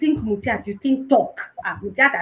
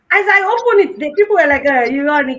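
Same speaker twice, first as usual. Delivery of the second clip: excited retelling; 5 semitones higher, 8 dB louder than usual. Retold or imitated. Group mocking her account drop.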